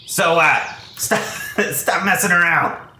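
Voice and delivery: gruff scolding. Acted. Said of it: "he says, uh".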